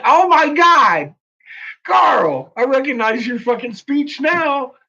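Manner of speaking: high, loud, drawn-out re-enacted shock; astonished recognition. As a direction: call Carl out in mock amazement. Re-enacts own reaction to Carl.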